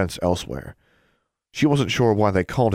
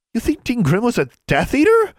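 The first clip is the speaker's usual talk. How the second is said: high, loud shocked question; scandalized disbelief, squeaking upward; horrified blurting; 5 dB louder than usual. Acted. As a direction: blurt it out aghast, pitch leaping up. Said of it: "Anna blurted out in a horrified whisper".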